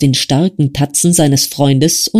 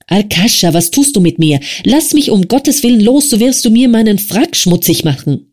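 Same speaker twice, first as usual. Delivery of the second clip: lightly voiced frail young man, higher and begging; a weak friend begging to be let go; pleading Wasja; 6 semitones higher than usual. Wasja begging Arkadi to release him, pleading.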